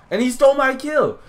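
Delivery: high, loud complaint; high-pitched gamer outrage; outraged yell. Real, not acted. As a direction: shout in indignation at a teammate. Teammate stole his kill.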